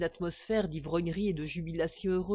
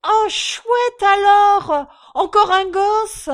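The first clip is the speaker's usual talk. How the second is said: slow, much higher, loud delighted cry; joyful cry; 12 semitones higher, 14 dB louder, slower than usual. A narrator's reading. Drunk father cheering at another baby, overjoyed. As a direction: shout for joy. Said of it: "cri de réjouissance ébaudi".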